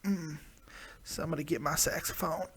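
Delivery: soft, playful seductive voice; hushed and mock-sultry; mock sexy. Playing up the sexy voice.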